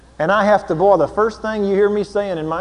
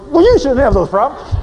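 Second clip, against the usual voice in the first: louder, quick brush-off; curt dismissive husband; 7 semitones higher, 5 dB louder, faster than usual. Retold or imitated. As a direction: cut her off impatiently. Husband's curt reply quoted.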